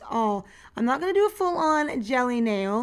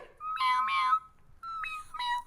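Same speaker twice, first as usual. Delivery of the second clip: tiny, very high cat meows; mock meows; 5 dB quieter, much slower than usual. Vlogger playfully meowing over her new cat figure, high kitten meows. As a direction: meow like a little kitten. Imitating the cat she got.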